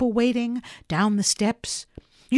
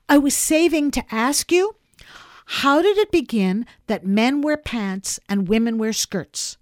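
put-on higher, louder child's voice, earnestly asking; inquisitive little girl; 5 semitones higher, 6 dB louder than usual. Girl asking Popper about men and women, earnestly curious. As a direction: pepper a grown-up with earnest, pressing questions.

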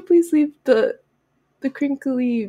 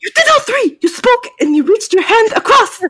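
freaking out, very high and loud; hammed-up outraged outburst in a fake accent; Kamun Ra; 10 semitones higher, 5 dB louder than usual. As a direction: shriek the accusation like a flustered villain. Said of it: "He freaks out".

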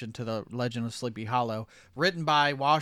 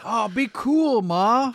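put-on whiny teen; 9 semitones higher, 5 dB louder, much slower than usual. Teen begging mom for cigarettes.

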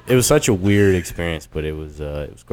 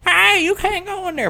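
high-pitched stripper voice; imitating strippers stopping a customer; mock stripper's warning. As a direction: call out in a put-on, much higher voice. Acting out strippers yelling at him.

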